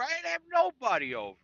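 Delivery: put-on high, whiny teen denial; mock defensive kid. Son denying to mom.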